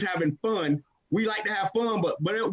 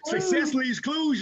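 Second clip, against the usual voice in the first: emphatic shout; 7 semitones higher, much slower than usual. Real, not acted. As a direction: drive it home like a hype man. Hyping the catchphrase to the audience.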